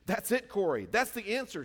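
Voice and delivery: high, loud delighted discovery; excited. Retold or imitated. Betsy to Corrie, delighted excitement. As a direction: burst out with the answer.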